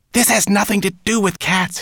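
high, loud furious rat; angry hiss. A narrator's reading. Rat rejects brownie's teasing, hissing angrily. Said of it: "hissed Rat angrily".

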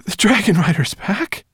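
loud, high incredulous question; astonished. A narrator's reading. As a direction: cry it out in amazement, rising at the end. She-dragon astonished at the news.

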